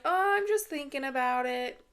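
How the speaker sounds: high, sing-song brush-off; mock evasive. [name] deflects question about leaving.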